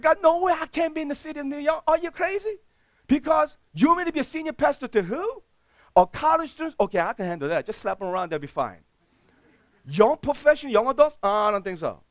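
high, loud, fast panicked pleading with God; a frantic, high, loud objection to God; panicked protest. Retold or imitated. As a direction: protest to God in rising alarm. Retelling his protest to God.

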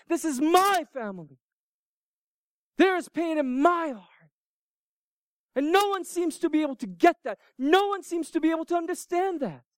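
high, impassioned protest; insistent, pained appeal; Mary's anguished plea. Acted. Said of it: "That's Mary's question".

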